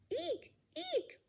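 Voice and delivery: high squeaks; tiny high eeks; squeaky shoe. Pretend. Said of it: "like a squeaky shoe".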